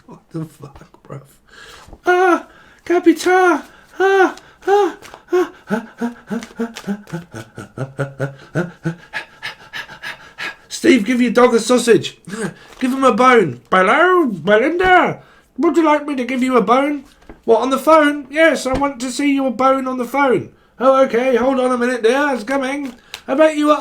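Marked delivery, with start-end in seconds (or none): 2.0-9.0 very high "ah" cries breaking into short bursts; high sung ahs. Pretend. Reader voicing what they hear, high comic ahs. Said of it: "That's what I'm hearing". 10.7-23.8 high, put-on sing-song character voice; comic dotty character. Comic caller offering a bone on the phone, high put-on voice.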